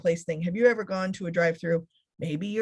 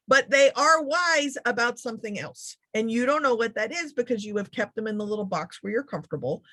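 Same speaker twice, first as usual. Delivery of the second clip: normal talking voice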